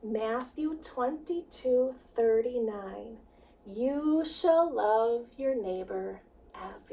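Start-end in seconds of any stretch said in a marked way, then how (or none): none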